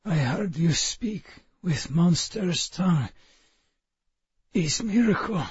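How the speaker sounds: put-on thin, high, soft Russian-accented rasp. A narrator's reading. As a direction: speak thinly, wounded, Russian accent. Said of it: "his voice thin as twice-strained tea".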